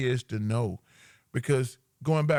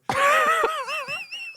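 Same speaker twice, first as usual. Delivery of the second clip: high squealing laugh. Real, not acted. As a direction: burst into a piercing laughing squeal. Reacting to his own hypothetical.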